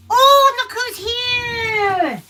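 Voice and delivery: high, drawn-out delighted greeting; light up as the dog walks in; happy squeal to the dog; excited greeting. Real, not acted. Greeting her dog in baby voice.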